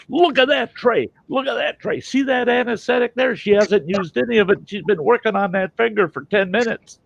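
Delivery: high, frantic tattling; outraged complaint. Retold or imitated. Patient showing the surgeon the unused anesthetic, outraged complaint. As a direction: plead the case in a worked-up high voice.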